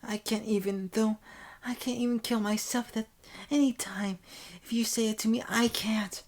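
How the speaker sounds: put-on high-pitched, distressed girlish voice; tearful protest. Senpai confessing she can't do it, tearful high protest. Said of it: "Tears course down her face".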